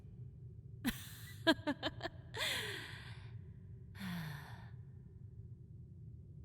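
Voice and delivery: airy drawn-out chuckle; amused hushed cackle; long breathy villain laugh. Acted. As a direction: let a soft laugh trail on.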